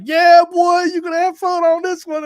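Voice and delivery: high, loud, gleeful yell of a grinning pilot; gleeful pilot taunt. Retold or imitated. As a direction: crow it like a pilot who loves your fear. Pilot grinning at scared passenger.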